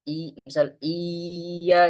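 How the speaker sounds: iya stretched out too long, slow; overlong vowel. Shown as a demonstration. Teacher demonstrates an overlong vowel as a mistake. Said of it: "nggak boleh dipanjangin".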